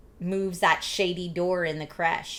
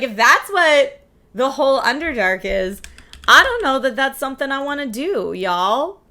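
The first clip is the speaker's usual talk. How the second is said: higher, louder exasperated whine; whiny reaction; 6 semitones higher, 9 dB louder than usual. Retold or imitated. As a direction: complain it like a tired gamer. Quoting own reaction, whining.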